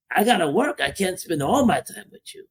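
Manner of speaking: ordinary talk, nothing marked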